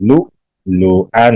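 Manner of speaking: slow "لؤلؤا" with a jolting glottal break; hamza with jolt. Shown as a demonstration. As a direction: stop short on the hamza in "لؤلؤا". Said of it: "جھٹکا لگائیں گے".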